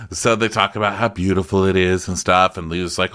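normal talking voice

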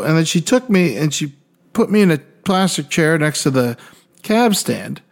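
ordinary talk

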